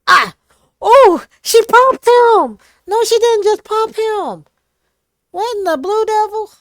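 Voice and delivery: excited yelps. Real, not acted. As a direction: shriek with glee at the twist. Reacting to a game twist.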